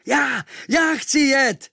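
high, loud, slow eager shout; shouting at full lungs. Acted. The boy shouts to the sailors to take him, high and loud. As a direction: yell to be picked for the ship. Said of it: "volal jsem z plných plic".

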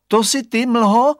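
high, loud call into the fog. Acted. Boy calls into the fog.

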